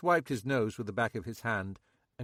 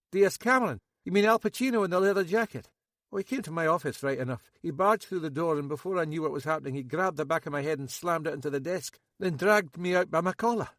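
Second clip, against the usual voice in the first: higher, louder nervous local man; Max; 7 semitones higher, 6 dB louder, faster than usual. A narrator's reading. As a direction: play Max high and jittery. Max recounts assault.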